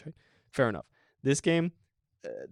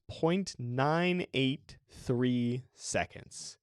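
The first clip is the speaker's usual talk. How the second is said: slow, deliberate; 2 semitones higher, much slower than usual. Shown as a demonstration. Spelling out record time slowly.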